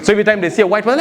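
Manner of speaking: ordinary talk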